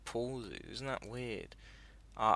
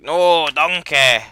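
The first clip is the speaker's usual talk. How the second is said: loud, slow Scottish ogre voice, a bit higher; Shrek voice; 5 semitones higher, 19 dB louder, much slower than usual. Pretend. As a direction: boom it out like Shrek lecturing about onions. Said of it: "then Shrek's like".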